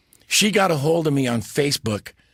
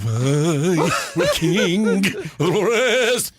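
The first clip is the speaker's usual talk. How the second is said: slow drawn-out lion voice; a hint of the Cowardly Lion, stretched; 5 semitones higher, much slower than usual. Recalling his stage role, slow lion impression. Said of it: "I was the lion".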